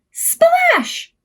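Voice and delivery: playful high splash noise; splash effect. Acted. Sound effect read to children.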